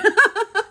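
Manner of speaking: loud, high burst of laughter; amused laugh. Real, not acted. Cracks up at the buffoon grudge.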